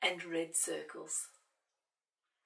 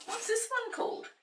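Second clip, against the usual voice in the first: high, louder puppet voice; puppet asking; 5 dB louder than usual. Pretend. Puppet voice asks.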